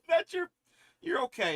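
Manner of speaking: loud, high reassurance to the cat. Real, not acted. Reassuring the cat about her blanket in high baby talk.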